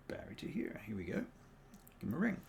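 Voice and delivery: normal talking voice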